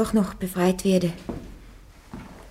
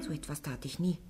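quiet denial; 4 semitones lower, 14 dB quieter than usual. Acted. Joan answers the shouting judge.

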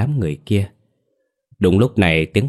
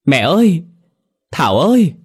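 loud desperate call; 9 semitones higher, 7 dB louder than usual. A narrator's reading. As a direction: cry out loudly for your lost family. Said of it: "Tiếng gọi lớn của hai người". (gọi to thất thanh, giọng cao)

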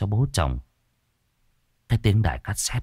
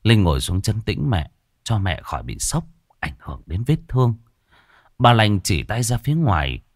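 plain reading voice

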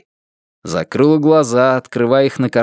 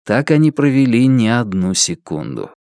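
plain reading voice

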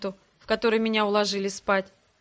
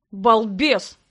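loud, indignant rebuke; mildly outraged insult, firmly projected; 5 dB louder than usual. A narrator's reading. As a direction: scold with understated outrage. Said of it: "возмущался один голос, густой, низкий и бархатистый".

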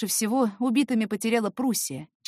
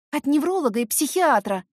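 higher, louder, irritated snap; irritated reply; 6 semitones higher, 5 dB louder than usual. Acted. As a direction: answer with clear irritation. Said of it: "раздражённо ответила".

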